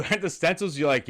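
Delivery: ordinary talk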